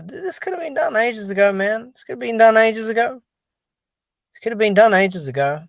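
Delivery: fed up, hammering the point home; exasperated. Real, not acted.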